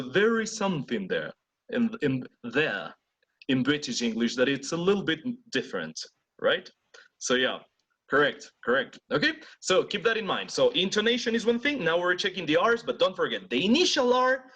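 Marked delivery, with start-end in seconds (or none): none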